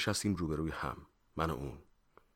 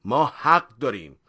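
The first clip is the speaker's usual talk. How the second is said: loud rebuke; 6 semitones higher, 13 dB louder than usual. A narrator's reading. Officer asserts authority over suspect, loudly rebuking. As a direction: thunder it, weighting each phrase. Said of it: "مأمور با صدای بلند تشر زد".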